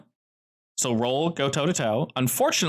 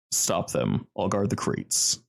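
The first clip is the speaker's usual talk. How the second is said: low villain command; 6 semitones lower, slower than usual. Acted. GM voices enemy leader.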